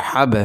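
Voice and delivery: হাব with a short bounced release on the b; qalqala bounce. Shown as a demonstration. Teacher repeats the qalqala rule, bouncing b at the end of হাব. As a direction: push off the final b of হাব. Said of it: "ধাক্কা মারতে হবে".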